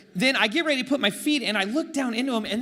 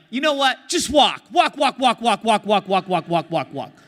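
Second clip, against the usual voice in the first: angry shout; 3 semitones higher, 9 dB louder than usual. Retold or imitated. Oakland Chris yelling at the drunk lady to keep walking. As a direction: bark it like tough Oakland Chris. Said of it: "Oakland Chris came out".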